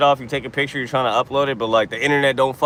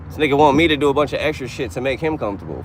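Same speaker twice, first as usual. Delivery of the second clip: normal talking voice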